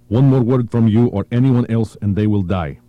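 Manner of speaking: loud threat through gritted teeth. Acted. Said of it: "he said through his teeth".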